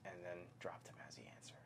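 soft whispered aside. Real, not acted. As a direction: breathe it under your voice. Whispered aside while reading.

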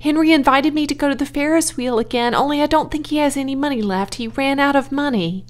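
high, fast, eager explaining; eager child. A narrator's reading. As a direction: rattle it off like a child.

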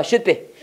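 should be를 '슛삐'로 짧게; should의 d를 받침처럼 막고 be 붙이기; 받침으로 발음. Shown as a demonstration. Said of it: "다 받침을 읽어야 돼". (should be, 두 박자 '슛-삐')

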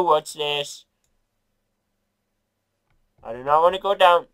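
normal talking voice

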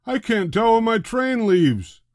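high, loud complaining man; upset passenger. A narrator's reading. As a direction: complain up at the board.

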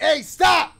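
sudden loud high shout to halt him; shouting stop. Real, not acted. Player yelling at a staring hooded man, loud shout. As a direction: yell at the stranger to stop.

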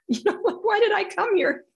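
high, loud exasperated protest. Retold or imitated. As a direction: protest as if fed up. Retells exasperated thoughts at the healer.

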